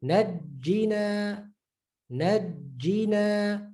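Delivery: Najjina, slow, the jj held; held doubled jim. Shown as a demonstration. Teacher drilling the doubled j slowly. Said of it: "the emphasis aspect is very important".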